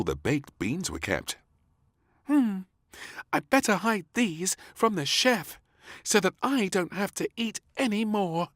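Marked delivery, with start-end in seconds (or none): 2.3-8.5 put-on high, loud little-girl voice scheming; plotting like a naughty little girl; little princess. A narrator's reading. Princess plots to hide beans from chef.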